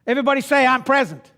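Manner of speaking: high, loud revival exhortation; fired-up preaching. Acted. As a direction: lead the crowd like a preacher. Preaching at full force.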